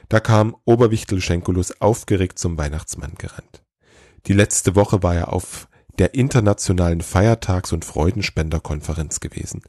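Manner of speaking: ordinary delivery, nothing marked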